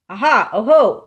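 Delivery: loud, eager cry of discovery; excited exclamation. Acted. Gruffalo's child spots a trail in the snow, loud excited cry. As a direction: call out thrilled at a find.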